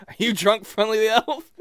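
normal talking voice

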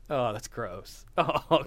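ordinary talk, nothing marked

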